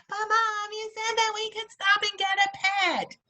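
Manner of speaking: put-on high, pleading child; a child reminding mom of her promise, high and pleading; whiny alien kid. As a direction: beg your mom for the pet she promised. Kid voiced in the acted scene.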